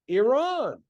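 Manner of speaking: "Iran" as ee-RAHN, pitched high, falling; ee-RON. Shown as a demonstration. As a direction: teach "Iran" as ee-RAHN to a class. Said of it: "just as easy is this one".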